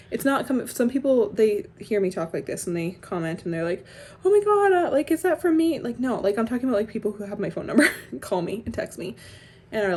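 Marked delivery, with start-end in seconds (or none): 4.2-5.8 high-pitched imitation of a touchy follower; mock alarm, starting high and loud; mock worried commenter. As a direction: imitate someone taking it personally, pitched up. Mimicking commenters who take it personally.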